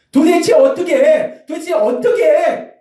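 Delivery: high, loud and drawn-out; slow exasperated yell; mock angry outburst. Shown as a demonstration. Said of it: "이렇게 화내는 게 아니라". (화내는 흉내로 천천히 크게 외치는)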